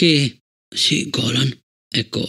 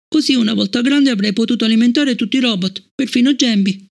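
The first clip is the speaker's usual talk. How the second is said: put-on high young girl's voice, hurt and puzzled; a child asking why she is disliked; child Fallon; 9 semitones higher than usual. Fallon asking Bliss about Travis, high child voice.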